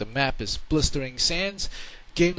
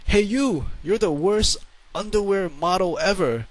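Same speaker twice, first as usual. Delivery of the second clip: higher-pitched, mildly raised heckler voice; mock heckling yell; 4 semitones higher, 3 dB louder than usual. Some guy yelling at an underwear walker, mock heckle. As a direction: imitate a passer-by calling out an insult. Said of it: "some guy yells at me".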